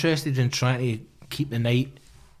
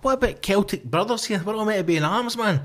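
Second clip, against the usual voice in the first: high pleading; 7 semitones higher than usual. Retold or imitated. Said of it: "I was like".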